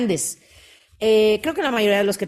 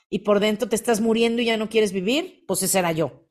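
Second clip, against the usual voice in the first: ordinary talk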